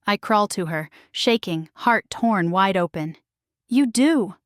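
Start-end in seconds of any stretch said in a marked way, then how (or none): none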